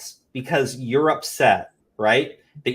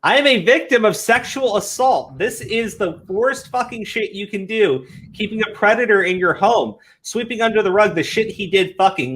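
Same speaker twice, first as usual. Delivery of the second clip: slightly higher, mock-indignant reading; lightly mocking victim post; 7 semitones higher than usual. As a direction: read the angry post with a hint of parody. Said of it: "this one guy was streaming".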